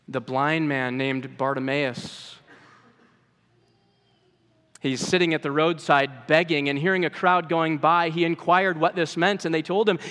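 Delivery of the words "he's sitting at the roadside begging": ordinary talk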